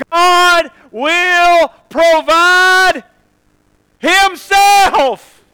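very slow, high and loud; real slow. Shown as a demonstration. Preacher repeating the line slowly for the congregation. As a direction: drag out each word. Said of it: "or again real slow".

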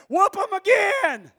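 high, drawn-out yell of a rowdy spectator; front-row cheering. Acted. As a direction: egg on the fight from the front row. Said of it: "sit on the front row of that and went".

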